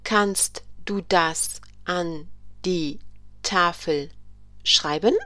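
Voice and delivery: slow repetition, word by word; slow repeat. Shown as a demonstration. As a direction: repeat it in chunks for learners. German teacher repeats the sentence.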